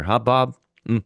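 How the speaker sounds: ordinary delivery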